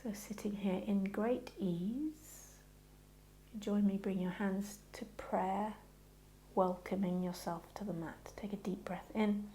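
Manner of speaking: hushed yoga teacher voice; guided meditation. Acted. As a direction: settle them gently before the chant. Settling class for chant.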